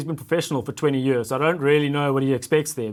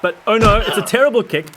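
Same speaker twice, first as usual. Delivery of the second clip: excited commentary; 6 semitones higher, 6 dB louder than usual. Pretend. Commentator reacting to the bad kick.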